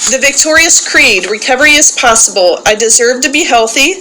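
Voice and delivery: loud call-and-response recitation, each line declared for the group to repeat; loud group-leader recitation; leading a creed. Acted. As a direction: proclaim it to the room like a rallying creed. Leads daily creed recitation.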